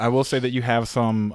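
usual speaking voice

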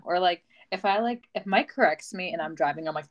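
normal talking voice

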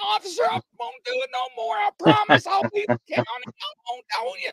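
very high, fast imitated begging; mock desperate pleading. Officer imitating the vagrant promising the officer, high fast pleading. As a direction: rattle off the promises in a frightened squeal.

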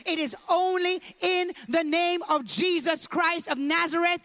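loud, slowing sermon declaration; stretched-out pulpit proclamation; preaching at full force. Acted. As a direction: lean into every word like a preacher. Preaching the name of Jesus.

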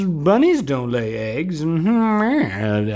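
hammed-up, high-pitched hack comic bit; hacky comedian routine. Shown as a demonstration. Comic mocking his own Easter bunny routine, hammed-up hacky delivery. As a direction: draw it out high, like a corny stand-up. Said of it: "spare you my hacky rendition".

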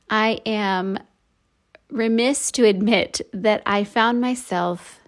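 normal talking voice

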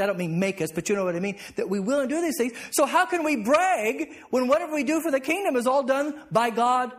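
ordinary talk, nothing marked

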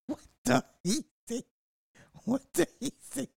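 helpless laughter, voice squeaking up high and thin; cracking up. Real, not acted. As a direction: laugh so hard the words barely get out. Cracking up at chat comments.

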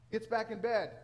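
normal talking voice

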